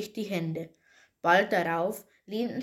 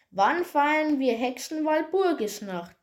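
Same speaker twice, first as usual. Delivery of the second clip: high witch voice, mildly shouted; witch's mild shout; 7 semitones higher, 3 dB louder than usual. A narrator's reading. Witch puts first riddle to Peter, mild high shout. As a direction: call it out higher, as a shrill witch. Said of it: "schrie die Hexe".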